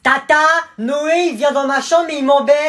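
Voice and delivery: loud, high, slow tattling yell; little kid tattling to auntie, shouting; whiny call for auntie. Acted. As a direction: wail for the aunt from the bedroom. Kid calls aunt for help.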